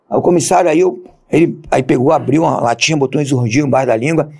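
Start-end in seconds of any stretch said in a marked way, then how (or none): none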